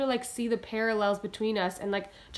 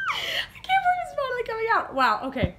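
giddy laughter; 11 semitones higher, 5 dB louder than usual. Real, not acted. Giddy sign-off on release day.

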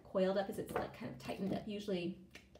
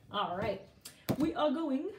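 usual speaking voice

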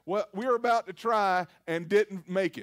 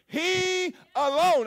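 He alone, higher, louder and slow, alone stretched; emphatic declaration; 7 semitones higher, 5 dB louder, much slower than usual. Shown as a demonstration. Pastor proclaiming to the congregation, slow and emphatic. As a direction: proclaim it slowly like it's settled. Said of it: "say it till I'm blue in the face".

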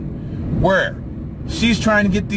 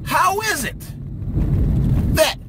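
exasperated demands; 6 semitones higher than usual. Acted. Ranting questions at Hillary Clinton.

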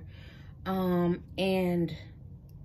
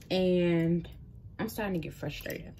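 normal talking voice

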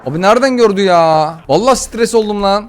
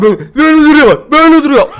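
mock imitation; 8 semitones higher, 8 dB louder than usual. Mocking how the mother stands.

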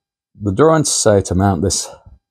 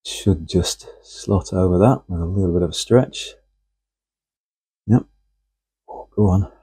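ordinary talk, nothing marked

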